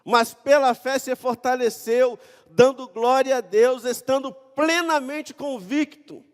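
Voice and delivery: loud, high-pitched declaimed Bible verses; preacher's ringing, emphatic reading voice; impassioned scripture reading. Acted. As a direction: proclaim the passage at full force. Reads scripture at full force.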